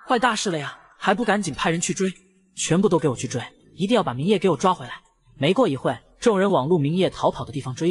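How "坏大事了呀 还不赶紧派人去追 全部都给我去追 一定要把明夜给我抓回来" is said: ordinary delivery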